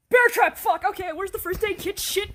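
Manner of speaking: sudden high yelps of pain, loud; pained yelling. Acted. Roommate stepping in a bear trap, loud high pained yells. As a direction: yell out as the trap snaps shut.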